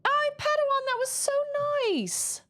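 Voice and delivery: high, bright Australian accent attempt. Thanking a viewer in a put-on Australian accent. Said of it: "That's Australian".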